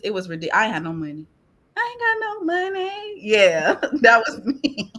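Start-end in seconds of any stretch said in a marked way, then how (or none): 1.8-3.1 high squeaky voice, much higher; mock broke whine. Mocking her broke self in a high whine.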